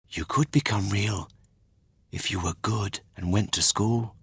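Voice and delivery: quiet, kind fairy voice; softly spoken fairy. Acted. Fairy speaks in the story.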